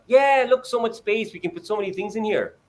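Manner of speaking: put-on high, eager parent voice coaxing a child; bright and excited, selling the cabinet to a toddler; a parent gushing over a child's interest. Parent coaxing child, Sunrise style.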